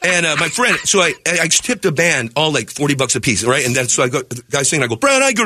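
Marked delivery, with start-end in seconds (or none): 4.9-5.4 mock singer voice. Retold club quarrel with singer.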